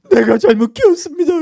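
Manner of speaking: high, loud tearful apology; desperate parent's public apology; pleading parent. Acted. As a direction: beg forgiveness for your child. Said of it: "그럽니까?".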